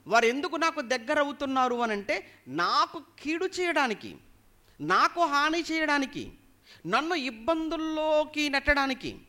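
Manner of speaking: high, insistent preacher's cadence; impassioned preaching. Real, not acted. Preacher voices psalmist's complaint about enemies, preaching impassionedly. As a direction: pile up each clause, high and forceful.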